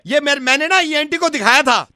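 ordinary delivery

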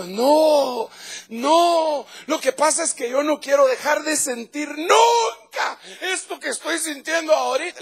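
high, tearful sobbing plea; crying woman. Retold or imitated. Woman weeping in church begging the feeling never stop, high and crying. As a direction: retell her weeping words through tears. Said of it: "ella llorando le dijo".

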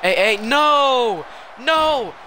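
long, high wailing no; loud drawn-out gamer panic; alarmed shout. Real, not acted. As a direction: cry out loudly in protest. Panics as opponent attacks in game.

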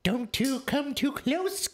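high, slow witch voice; creaky old witch threatening the kiddies; old hag. Pretend.

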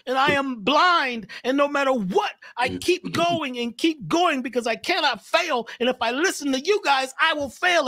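very high squeaky frog voice, firm and steady; a frog who won't be stopped; determined frog. Acted. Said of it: "He says".

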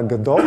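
Godot said guh-DOH, silent final t; French-style pronunciation. Shown as a demonstration. Speaker introducing the engine, Godot said guh-DOH. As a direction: say Godot the French way, ending on an open oh. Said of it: "or as some people say it".

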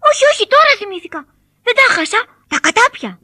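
high, loud and fast puppet fib; hasty lie. A narrator's reading. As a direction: rush the lie out. Pinocchio lies hastily. (βιαστικό ψέμα)

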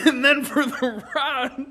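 laughing retake. Real, not acted. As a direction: try again through laughter.

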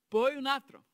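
poi un altro, più alto e forte sul "poi"; enfatico. Acted. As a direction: scandisci "poi" con forza. Counting the laps round Jericho. (conta i giri ad alta voce, con enfasi)

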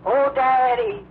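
high, loud wailing call for her daddy; lost blind girl wails high and loud for her daddy; pathetic cry. Retold or imitated. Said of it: "Coming along like that".

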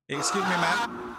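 slow, loud, high call with a laugh. Real, not acted. Shouting at demon, laughing.

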